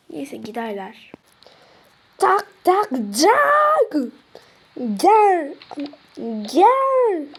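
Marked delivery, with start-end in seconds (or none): 2.2-3.9 slow loud higher knock-knock; mock knocking. Kapı vurma sesini taklit. 5.0-7.3 teacher calling in. Acted. Öğretmen içeri çağırıyor.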